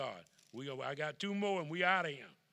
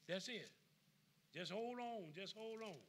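soft comfort; 5 semitones higher, 11 dB quieter than usual. Acted. Softened comfort.

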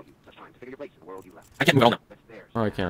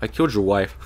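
ordinary delivery

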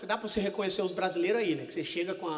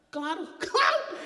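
high, slow laughing mock eagerness; imagined investor eagerly accepting, hammed-up laugh; eager laughing yes; 9 semitones higher, much slower than usual. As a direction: gush it, laughing.

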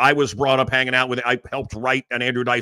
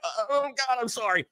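high, soft, whimpering imitation; small, tearful mock apologizing; mock apology; 7 semitones higher, 6 dB quieter than usual. Host mimics a mourner sorry for crying, high and meek.

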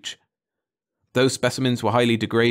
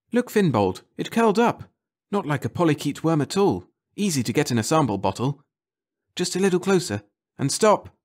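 higher, eager directions; excited guiding; 6 semitones higher than usual. Acted. Leakey guiding Finbold nearer, higher and eager. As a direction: coax him closer.

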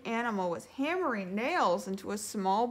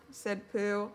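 plain reading voice

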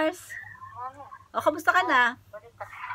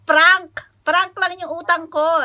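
loud, punchy shout of the word; loud reveal; 5 semitones higher, 8 dB louder, slower than usual. Real, not acted. Vlogger tells Mars the loan was a prank, loud shout. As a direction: burst out with the reveal.

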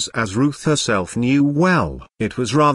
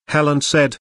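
plain reading voice

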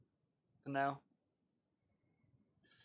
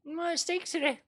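mimicking; 12 semitones higher than usual. Echoes game character's complaint.

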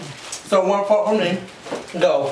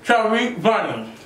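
mouth full; 4 semitones lower than usual. Real, not acted. Chubby bunny said through marshmallows.

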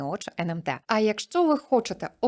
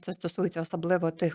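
ordinary talk

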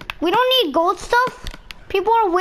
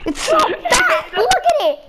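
laughing; 8 semitones higher than usual. Real, not acted. Player cracking up at his game character, laughing. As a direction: squeeze the words out through real giggles.

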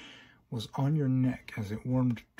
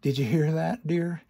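mocking creature voice; 5 semitones higher, 5 dB louder than usual.

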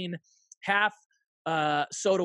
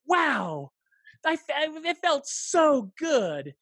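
delighted; 10 semitones higher, 4 dB louder, much slower than usual. Retold or imitated. Overwhelmed by coach's praise.

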